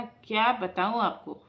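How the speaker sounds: ordinary delivery, nothing marked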